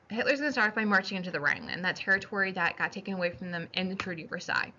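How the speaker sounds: normal talking voice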